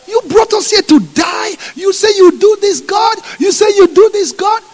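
high, loud accusing shout; panicked shouting crowd. Acted. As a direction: yell at God with the enemy closing in. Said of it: "they were like".

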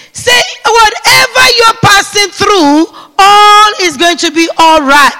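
fervent shouted declaration; say it like you mean it. Shown as a demonstration. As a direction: put your whole heart into it. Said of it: "Say it like you mean it".

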